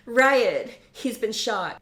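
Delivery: high, loud panic on the name; panicked. Acted. Blakely realises Riot is shot, panicked. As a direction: cry out in alarm. Heroine sees him shot.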